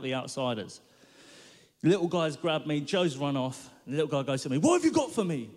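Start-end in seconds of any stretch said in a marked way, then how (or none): 4.6-5.5 higher, louder tough-guy demand; menacing demand. Retold or imitated. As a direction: bark it at the boy like a street bully. Mugger demands money.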